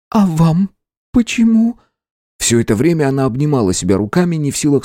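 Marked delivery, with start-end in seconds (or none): 0.1-1.8 put-on young woman voice. A narrator's reading. Clerk asks captain, timid.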